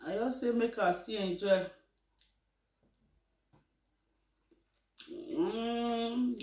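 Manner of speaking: speaking with food in mouth; mid-meal casual speech; chewing while talking. Real, not acted. Seen 0: Bowl in hand, fed herself just before; jaw moving while speaking.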